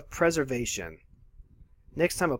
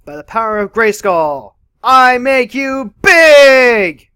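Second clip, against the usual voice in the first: booming, slow heroic proclamation, much louder and higher; He-Man battle cry; 9 semitones higher, 16 dB louder, slower than usual. Pretend. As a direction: roar it like a cartoon hero raising a sword. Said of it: "more of a magical girl transformation".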